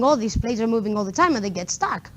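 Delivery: ordinary talk, nothing marked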